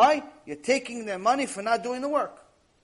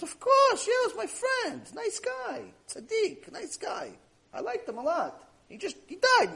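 very high-pitched imitation of the student; acted fond protesting; 12 semitones higher than usual. Student assuring the rabbi about his dead friend, high-pitched mock protest. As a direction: soar up high, as if excusing a dear friend.

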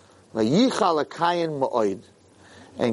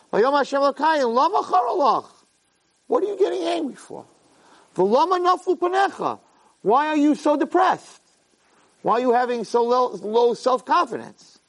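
much higher, gently questioning divine voice; God asking; 10 semitones higher than usual. Acted. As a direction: ask him kindly why he is upset. God questions the sulking Cain.

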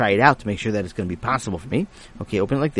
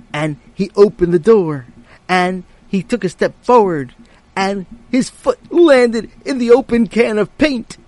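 building suspense, voice climbing higher; rising suspense; 9 semitones higher, 7 dB louder than usual. A narrator's reading. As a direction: climb higher and louder to the splash. Narration building to climax.

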